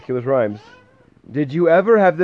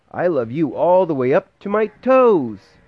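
higher, louder upside-down bunny voice; a small hare showing off; little hare's voice; 8 semitones higher, 7 dB louder than usual. A narrator's reading. Parent voicing the little hare to the baby. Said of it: "he said".

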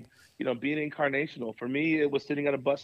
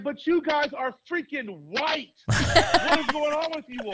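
much higher, louder accusing cry; frustrated outburst; 11 semitones higher, 4 dB louder than usual. Retold or imitated. Pastor scolding the board over money, higher and louder. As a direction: let the exasperation burst out. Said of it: "I got so frustrated. I said".

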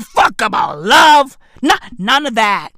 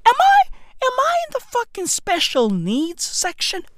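mock disbelief; 9 semitones higher than usual. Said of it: "I was like".

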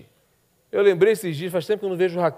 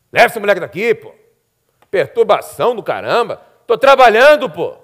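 dismissive father; 3 semitones higher, 9 dB louder than usual. Pretend. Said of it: "um pai totalmente indiferente".

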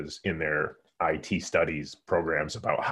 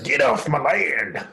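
put-on slow, higher cranky old man voice; grumpy landowner; 7 semitones higher, 3 dB louder, slower than usual. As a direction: bark it like an angry homeowner. Joking about shotgun on porch.

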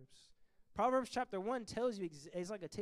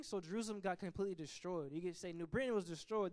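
ordinary talk, nothing marked

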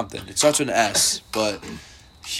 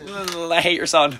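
mock greeting; 7 semitones higher, slower than usual. Joking line to friend's dad.

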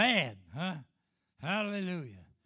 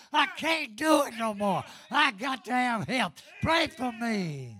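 preaching; 4 semitones higher, 6 dB louder than usual. Acted. Preacher shouting testimony.

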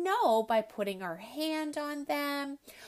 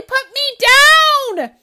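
high, louder put-me-down order; bossy demand; 21 dB louder, faster than usual. Acted. Ellie ordering the elephant to set her down, high and loud. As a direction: demand it from up in the air.

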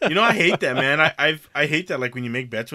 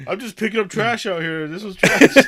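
mock innocent excuse; 7 semitones higher, 7 dB louder, slower than usual.

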